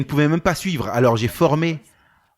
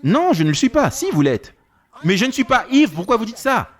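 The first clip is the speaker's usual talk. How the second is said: a woman protesting she is sober, higher; pitched-up offended denial; indignant woman; 8 semitones higher than usual. Retold or imitated. Woman denies being drunk, arguing.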